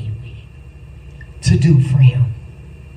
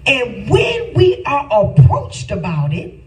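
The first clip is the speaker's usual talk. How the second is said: higher, building sermon cry into a sung shout; preaching at full force; 5 semitones higher than usual. Acted. Preacher calling his congregation to come clean, rising sung shout.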